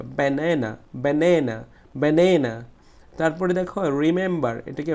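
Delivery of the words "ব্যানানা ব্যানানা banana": Banana with the middle "ne" punched; stressed middle syllable. Shown as a demonstration. Teacher drilling students, forceful middle syllable in banana. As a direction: hit the second syllable of banana harder each time. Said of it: "জোরে উচ্চারণ করতে হবে".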